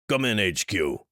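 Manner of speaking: put-on angry call into the radio, Finnish accent thickening; growled. Jonas radioing headquarters in a mild, contained growl. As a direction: growl the call sign, barely holding the fury. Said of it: "his accent thickening with anger".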